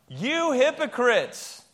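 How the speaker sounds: slow, loud call-out, each word hammered; loud slow shout. Shown as a demonstration. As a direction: shout it out like you mean it. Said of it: "Say it really loud".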